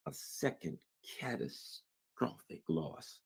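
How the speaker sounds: near-whispered, slow on catastrophic loss; hushed. Real, not acted. Host warning viewers of Trump's fate, hushed and grave. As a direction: drop to a grim hush. Dramatic reveal of the outcome.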